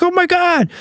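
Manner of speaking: put-on high, shouted, thrilled young fans; excited recognition. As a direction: shout it like spotting a hometown hero. Kids recognising him, excited.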